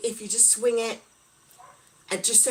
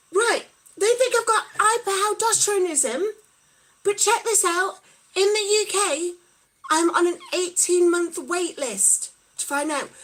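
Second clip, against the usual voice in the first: indignant; 6 semitones higher, 6 dB louder than usual. Real, not acted. Indignant about long UK wait list.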